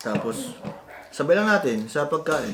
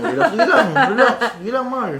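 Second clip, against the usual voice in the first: laughing; 11 semitones higher, 7 dB louder than usual. Real, not acted. Teasing spouse, laughter heard.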